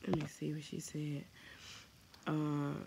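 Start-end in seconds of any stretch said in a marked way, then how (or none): none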